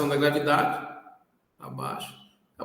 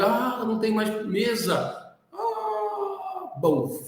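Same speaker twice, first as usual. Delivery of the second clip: bolinha de desenho assustada; 7 semitones higher, much slower than usual. Pretend.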